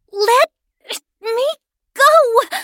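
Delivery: high, loud, broken word by word; struggling yell. A narrator's reading. Jules struggles in Kenny's grip, desperate yell. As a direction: fight free between each word.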